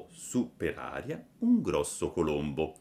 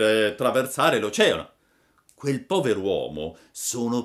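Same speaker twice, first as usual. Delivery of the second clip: loud, swooping, raised character voice; pigeon storyteller, emphatic; 6 semitones higher, 8 dB louder than usual. A narrator's reading. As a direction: proclaim the father's travels as the big pigeon. Pigeon character tells of Geppetto.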